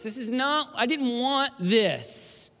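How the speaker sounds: high, strained, drawn-out dismay; pleading that it not happen, voice lifted high; anguished protest. Retold or imitated. Recalls dreading mother's approach.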